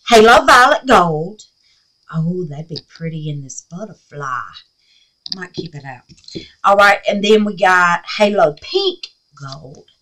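ordinary talk, nothing marked

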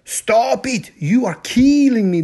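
playful kitten cry. Acted. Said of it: "said Scapito".

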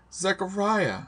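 high, anguished cry of a name; high-pitched accusing outcry. A narrator's reading. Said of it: "I cried out".

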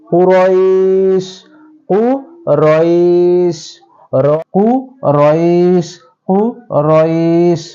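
কুরইশ with the ay stretched one alif long; slow stretched কুরইশ; drawn-out Quraysh. Shown as a demonstration. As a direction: hold the ai of কুরইশ slowly before stopping. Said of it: "এক আলিফ টানতে হবে".

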